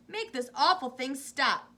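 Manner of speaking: loud, slow commanding demand; ordered. A narrator's reading. Mister T V demanding Wonka stop the lift, loud order. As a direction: bark the order. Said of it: "ordered Mister T V".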